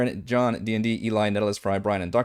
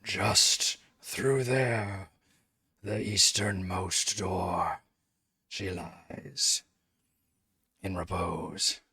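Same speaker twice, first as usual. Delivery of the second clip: very slow, softer, almost breathed; slow soft guardian voice; 2 semitones lower, 10 dB quieter, much slower than usual. Acted. Guardian answers the paladin's question, slow and hushed. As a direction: let each phrase drift out slowly from the dark. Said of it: "and then he says".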